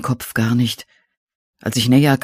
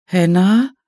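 higher, louder questioning call with steep rise; frightened, louder; 7 semitones higher, 5 dB louder than usual. A narrator's reading. Narrator as frightened caller asking into the dark for Hannah, afraid, louder. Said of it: "fragte ich ein wenig lauter, mit derselben vor Schreck zitternden Stimme".

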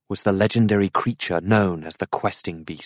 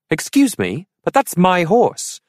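indignant objection; 11 semitones higher, 5 dB louder than usual. A narrator's reading. Arthur objects to horse theft.

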